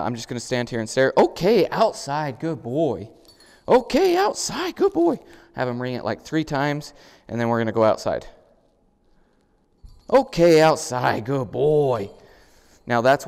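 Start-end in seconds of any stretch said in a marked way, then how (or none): 1.2-3.1 loud, high puppy praise with "outside" punched out; stress on outside. Real, not acted. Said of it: "I'm gonna start emphasizing the word outside". 3.7-5.2 very high, loud, drawn-out praise with "outside" ringing out; stress on outside. Real, not acted. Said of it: "I'm gonna start emphasizing the word outside". 10.1-12.1 loud, slow puppy praise with "outside" stretched; stress on outside. Real, not acted. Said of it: "I'm gonna start emphasizing the word outside".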